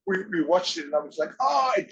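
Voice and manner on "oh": surprised shout. Retold or imitated. Reacting to the film.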